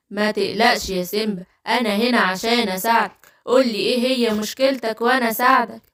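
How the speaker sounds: low, gentle wizard voice; low, calm storybook wizard; kindly wizard. Acted. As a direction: play a helpful magician soothing a cub, pitched low.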